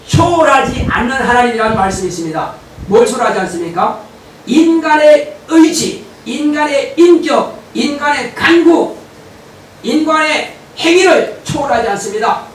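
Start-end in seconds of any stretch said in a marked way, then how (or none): 4.5-9.0 each item slow and loud; preacher stressing each human quality; emphatic list. Acted. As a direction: hammer each phrase slowly.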